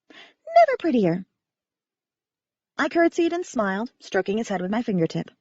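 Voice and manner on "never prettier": high, whistly little bat voice; a pet bat piping up with a high whistle; whistling bat voice. A narrator's reading. Bat complimenting Stevie on her dress, high and whistly. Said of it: "Belfry said on a whistle".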